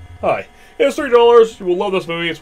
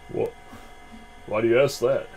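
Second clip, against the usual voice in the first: usual speaking voice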